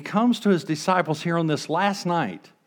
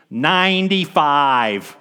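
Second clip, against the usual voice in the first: incredulous; 5 dB louder, much slower than usual. Acted. Incredulous reveal of grandmother's age.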